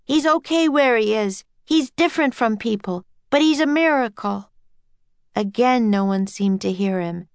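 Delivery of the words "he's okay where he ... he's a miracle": put-on higher, earnest boy's plea; a touch of yelling to a crowd; mild yell. A narrator's reading. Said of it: "yelled Jack".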